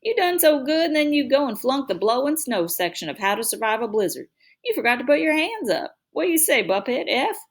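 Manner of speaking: high mocking big brother voice; teasing older brother. A narrator's reading. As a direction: tease him with a sing-song jeer. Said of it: "Byron caught his breath and said".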